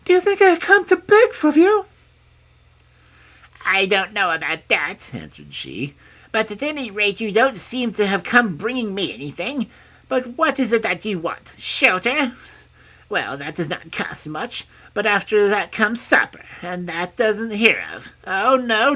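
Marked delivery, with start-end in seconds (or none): 0.1-1.9 very high, loud princess voice; indignant princess cry. A narrator's reading. Princess protesting she is no beggar, indignantly. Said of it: "cried the princess". 3.6-5.1 high, loud, doubting crone; sceptical old woman. A narrator's reading. Old woman doubting the princess, sceptically. 6.3-18.9 high, loud, carping crone; grudging old woman. A narrator's reading. Old woman reckoning what the princess will cost, grudgingly.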